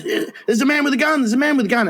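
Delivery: high, fast retold alarm; mock crowd panic. Crowd sees gunman at rally, high rushed mock panic. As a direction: imitate a stadium crowd spotting danger. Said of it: "and everyone goes".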